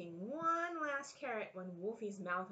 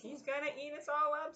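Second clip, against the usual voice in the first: Dot's warning; 8 semitones higher than usual. Shown as a demonstration. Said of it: "Okay, this is you".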